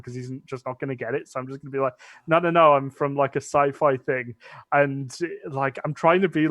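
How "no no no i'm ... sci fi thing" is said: ordinary talk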